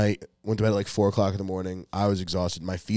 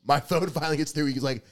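ordinary talk, nothing marked

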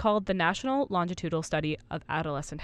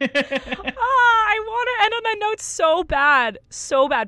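playful whine; 8 dB louder, slower than usual. Real, not acted. Wanting to end on joke.

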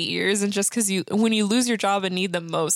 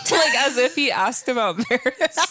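laughing; 4 semitones higher, 3 dB louder than usual. Real, not acted. Laughing retelling.

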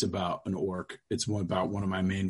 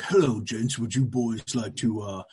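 lightly voiced oily street pimp; a hint of a smooth hustler luring two elves down an alley; half-hearted slick pimp pitch; sleazy pimp Mickey Mac; 4 semitones higher than usual. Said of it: "literally like that".

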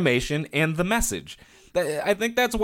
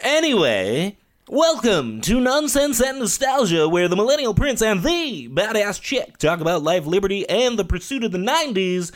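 announcer voice; 5 semitones higher than usual. Acted. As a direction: boom it out like a DJ. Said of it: "where that voice came from".

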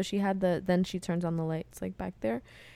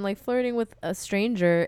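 ordinary talk, nothing marked

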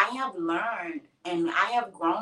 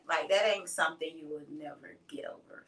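whispering; 5 semitones lower, 6 dB quieter than usual. Real, not acted. Grieving mother, hushed aside.

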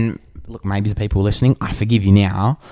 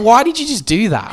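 high-pitched incredulous question; retold outraged demand; 11 semitones higher than usual. Gym-goer confronts man who moved his things, retold indignant demand. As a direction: demand an explanation, re-enacting the indignation.

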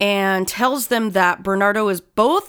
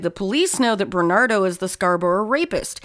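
ordinary delivery

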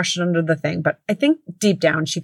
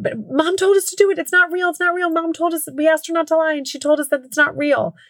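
put-on much higher child voice, matter-of-fact; little kid voice; 10 semitones higher than usual. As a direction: play the little brother explaining. Younger son voiced.

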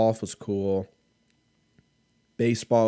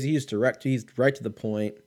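normal talking voice

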